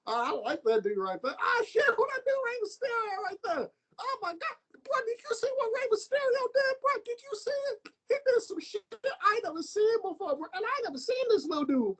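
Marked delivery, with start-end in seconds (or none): 1.4-7.0 high cracking shout of amazement; amazed fan. Retold or imitated. Fan reacting to Rey Mysterio. 7.0-12.0 high, cracking disbelief; amazed fan. Retold or imitated. Fan reacting to Rey Mysterio.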